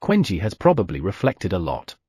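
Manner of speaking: usual speaking voice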